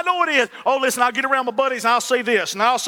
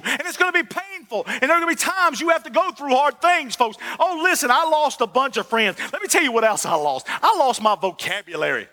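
ordinary delivery

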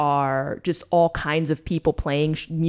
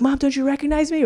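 much higher, fast pleading cry; desperate plea; 10 semitones higher, faster than usual. Retold or imitated. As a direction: beg his mother in mock panic. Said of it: "he's like".

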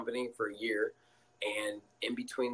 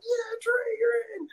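high and louder, whooping; cheering; 5 dB louder than usual. Retold or imitated. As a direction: cheer him into the game. Teammates cheering him on.

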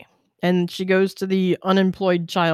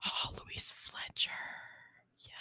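whisper; 23 dB quieter than usual. Real, not acted. Quietly recalling the name.

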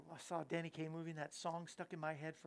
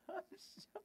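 laughing apology; 14 semitones higher, 8 dB quieter than usual. Real, not acted. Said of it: "laughing apology".

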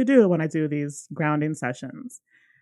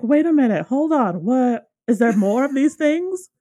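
alarmed disbelief; 7 semitones higher, 5 dB louder than usual. Retold or imitated. Alarmed at more entities.

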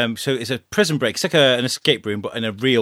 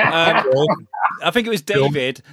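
ordinary talk

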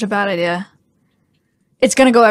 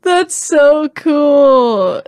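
very drawn-out marvelling; awed; 3 semitones higher, much slower than usual. Real, not acted. Friend marvelling at the opened bottle, slow awe. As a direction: stretch every word in slow awe.

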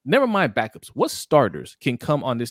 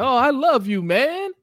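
high, slow gushing coach voice; mock coach gushing; 6 dB louder, much slower than usual. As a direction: gush like a sappy coach. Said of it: "John is like".

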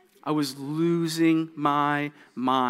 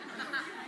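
soft laugh; 10 semitones higher, 14 dB quieter than usual. Real, not acted. Laugh after story.

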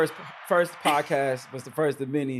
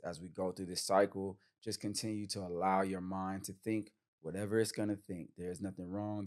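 calm grounding exercise. Acted. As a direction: lead them gently into calm breathing. Leads breathing meditation.

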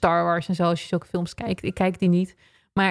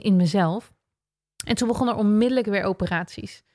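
ordinary talk

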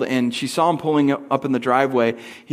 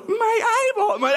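put-on extremely high child squeal; hammed-up wailing little-kid voice; three-year-old brother. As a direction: cry it out very high like a toddler. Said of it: "three and a half years old and goes".

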